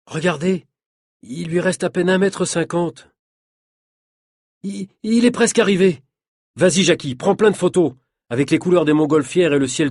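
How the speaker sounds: mounting suspense as the performer nearly arrives; excited. A narrator's reading. Onlooker urges Jackie to take photos of the stunt, excited.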